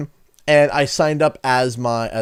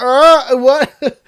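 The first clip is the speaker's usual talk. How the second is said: high, loud stammer of disbelief; hammed-up speechless shock, nearly laughing; dumbfounded; 12 semitones higher, 10 dB louder than usual.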